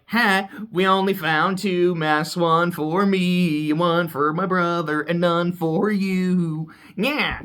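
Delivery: drawn-out, sing-song gloat; taunting. Retold or imitated. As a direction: stretch each phrase out to taunt. Retold taunt from the snooty sister.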